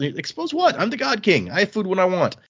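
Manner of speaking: high-pitched incredulous retort; acted imperious protest, pitched well up; indignant entitlement. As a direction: brush off the worry like royalty. Quoted God King dismisses her worry.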